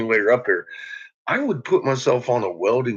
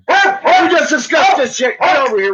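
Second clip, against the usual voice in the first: scolding shout; 12 semitones higher, 6 dB louder than usual. Real, not acted. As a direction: yell at the barking dog. Scolding the barking dog.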